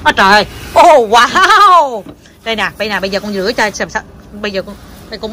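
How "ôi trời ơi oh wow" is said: high, loud, swooping wow; amazed cheer. Real, not acted. Mom watching her child wash vegetables, loud amazed delight.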